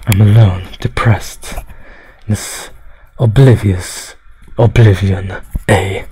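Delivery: low, sinking depressed delivery; mock gloom. Streamer laments being alone, mock depressed. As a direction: drop low into hammed-up misery.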